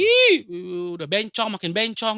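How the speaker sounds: mocking neighbor. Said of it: "yang asal kalau ngomong".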